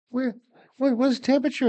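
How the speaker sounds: high-pitched imitation of an upset wife; retold near-crying wife. Husband retelling his wife's morning question, imitating her near tears. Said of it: "she's almost crying".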